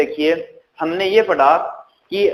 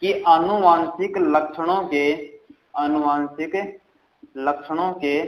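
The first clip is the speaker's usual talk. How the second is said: slow dictation; slower than usual. Shown as a demonstration. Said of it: "लिख लेना साथ साथ में".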